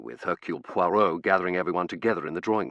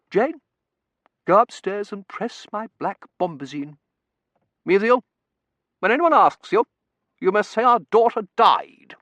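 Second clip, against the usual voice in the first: imperious mother; 13 semitones higher, 8 dB louder than usual. Acted. Mother orders the servants.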